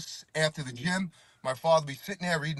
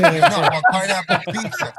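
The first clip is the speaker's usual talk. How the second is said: laughing; 5 semitones higher, 9 dB louder than usual. Retold or imitated. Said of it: "He goes".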